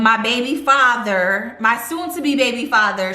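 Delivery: usual speaking voice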